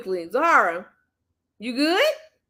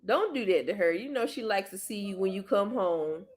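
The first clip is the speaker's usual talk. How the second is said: soft coaxing; 3 semitones lower, 7 dB quieter than usual. Real, not acted. Soothing child softly.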